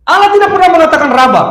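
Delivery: high, fast, forceful preaching; emphatic repetition. Real, not acted. Repeats the point forcefully for emphasis. (tegas, cepat, bernada tinggi)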